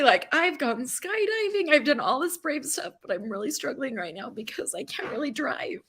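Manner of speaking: ordinary talk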